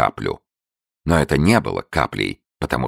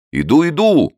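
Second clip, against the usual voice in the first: higher, louder call back; shout; 6 dB louder than usual. A narrator's reading. Abe shouts back to Li, louder. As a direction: shout that you are coming. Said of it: "крикнул мистер Эйб".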